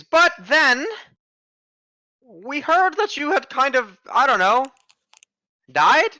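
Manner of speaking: a startled granny shopkeeper; old lady voice. Acted. Shopkeeper lady keeps talking.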